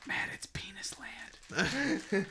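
slow whispered punchline; whispering. Real, not acted. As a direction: whisper it slowly, like a secret. Telling a joke to a friend behind.

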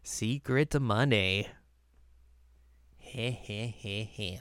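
slow, sly villain mutter trailing into a quiet snicker; sneaky snicker. Retold or imitated. Host imitating a scheming character, slow sly chuckle. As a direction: play the little gremlin plotting.

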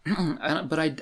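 normal talking voice

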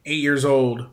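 ordinary talk

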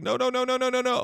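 high, frantic mock panic; a high mock plea while losing his footing; a man trying to keep his feet on the cart; panicked no's.

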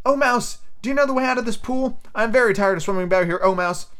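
high-pitched polite little Alice; high storybook heroine; voiced Alice. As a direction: call to the mouse in a high voice. Voicing Alice addressing mouse.

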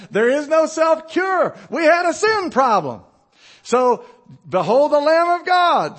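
loud, high preaching shout; preacher shouting the gospel at the church; preaching at full force. Acted. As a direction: build the sermon to a fervent peak. Preaching climax.

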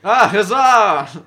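loud, slow, high huzzah; hearty cheer. Acted. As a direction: cheer it out like a tavern regular. Cheering greeting in character.

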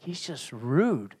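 slow, low, very soft mock complaint; hushed complaint. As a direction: confide it slowly, as a retold grievance. Retold complaint to pastor.

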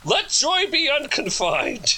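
slow, high, lofty recitation; declaiming grandly. A narrator's reading. Charles keeps declaiming to the prisoners, high and slow. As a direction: sweep each word out like a poet on stage. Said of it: "or declaimed Charles".

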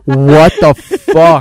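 slow, loud drawn-out disbelief; stunned dad. Retold or imitated. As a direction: stretch it like a father who can't believe it. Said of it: "my dad was like".

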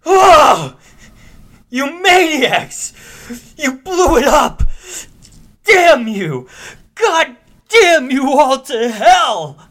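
high, loud anguished Charlton Heston yell; Planet of the Apes rage. Retold or imitated. A podcaster reenacting the movie ending, anguished shout. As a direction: scream it at the ruins like Taylor on the beach. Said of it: "doesn't work great as an audio clip".

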